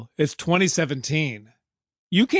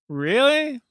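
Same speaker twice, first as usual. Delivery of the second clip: high, disbelieving really; incredulous; 10 semitones higher than usual. Real, not acted. Podcaster scoffing at the movie's gas plot, incredulous. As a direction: scoff at the absurd plot twist.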